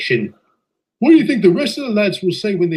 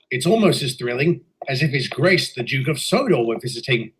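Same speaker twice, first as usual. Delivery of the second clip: ordinary talk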